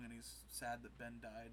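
normal talking voice

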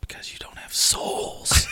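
slow, voiceless whisper; mock-sinister whisper. Ribbing a ginger friend, mock-sinister whisper. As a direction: whisper it slowly as a teasing jab.

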